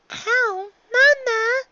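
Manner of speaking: put-on very high child voice, shouted; a little filly cheering for mom; young filly shouting. A narrator's reading. Daughter cheering her mother's birthday, very high child voice. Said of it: "Dicky said".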